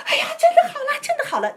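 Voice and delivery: very high, loud shriek of joy; healed woman jumping, squealing with joy; joyful cry. Retold or imitated. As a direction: leap up and squeal it. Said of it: "他就开始跳".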